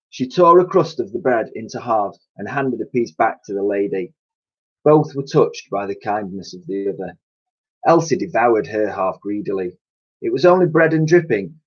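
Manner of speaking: usual speaking voice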